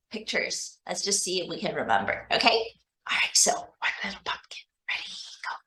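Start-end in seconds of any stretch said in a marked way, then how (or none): 3.1-5.5 soft whispered cue; whispering. Real, not acted. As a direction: whisper the start. Teacher whispers to start chant.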